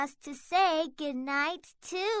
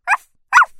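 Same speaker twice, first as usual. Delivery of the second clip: puppy barking; 15 dB louder than usual. Acted. Puppy barks at dog.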